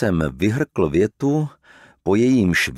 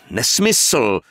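a sharp, louder cry of annoyance; angry outburst; 6 dB louder than usual. A narrator's reading. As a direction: snap it out indignantly. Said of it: "vykřikl rozlobeně Lorenz". (rozlobený výkřik)

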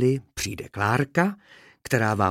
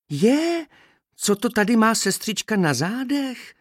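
high, surprised blurt; blurting young bee; 13 semitones higher, 5 dB louder than usual. A narrator's reading. Young bee blurts while climbing over her sister, surprised and high. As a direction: burst out in a much higher little voice. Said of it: "vyhrkla při přelézání mladá včelička".

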